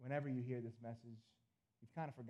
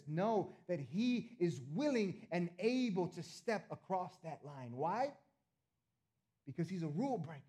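loud, higher, building sermon voice; full-force preaching; 4 semitones higher, 6 dB louder than usual. Real, not acted. Pastor assuring the church nothing stops Jesus, preaching full out. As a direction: drive it home to the congregation.

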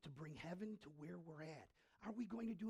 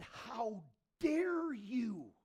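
slow indignant challenge; 5 semitones higher, 10 dB louder, much slower than usual. Retold or imitated. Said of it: "He said".

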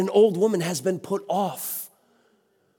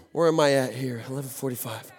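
much softer and lower, checking the clock to himself; muttered aside; 11 semitones lower, 8 dB quieter, faster than usual. Real, not acted. Preacher checking his time mid-sermon, quiet aside. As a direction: say it half to yourself, off mic.

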